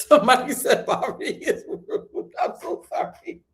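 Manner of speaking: laughing through the words, slow. Real, not acted. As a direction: laugh helplessly, then a breathy high apology. Laughing apology to chat.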